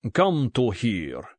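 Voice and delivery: put-on slow Russian-accented command; thick Russian accent. A narrator's reading. Russian gunman beckons captive Bond, thick Russian accent. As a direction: order him over in a heavy Russian accent. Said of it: "in a thick Russian accent".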